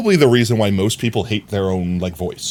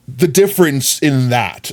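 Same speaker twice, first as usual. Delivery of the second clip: usual speaking voice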